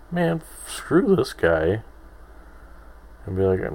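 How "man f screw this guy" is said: higher, mock put-off quote; imagined dismissive reaction. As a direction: act out an offended celebrity, lightly. Imagined comedians reacting to his podcast.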